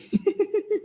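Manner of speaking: high-pitched giggle; short, high burst of laughter; amused giggle. Real, not acted. Streamer tickled by a viewer's question, high giggle.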